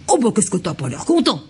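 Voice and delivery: lightly mimicking the baby's displeasure; mirroring parent. Shown as a demonstration. A hint of a parent mirroring the cross baby. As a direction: echo the baby's grumpy mood back at it. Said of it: "vous le faites en mirroring".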